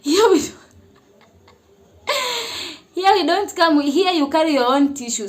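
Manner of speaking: firm, amused hospital cleaner; cleaning lady. Retold or imitated. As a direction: play the blunt cleaner setting a newcomer straight. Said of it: "And that mama was like".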